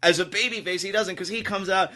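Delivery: ordinary talk, nothing marked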